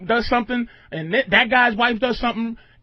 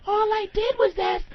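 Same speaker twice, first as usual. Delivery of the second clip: much higher feigned innocent wife voice; innocent wife mock; 12 semitones higher, 4 dB quieter than usual. Wife answering husband's accusation, high mock innocence. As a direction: play the wife, all wide-eyed innocence. Wife's innocent reply, retold.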